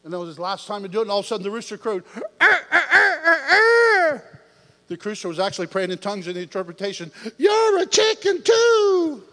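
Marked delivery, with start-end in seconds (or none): 2.4-4.2 high, loud crowing cries; rooster crow imitation. Preacher imitating the rooster crowing, high and loud. 7.4-9.2 high, loud, slow rooster taunt. Retold or imitated. The rooster mocking Peter in a high loud crow.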